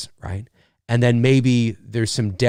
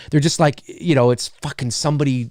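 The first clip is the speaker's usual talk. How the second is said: ordinary talk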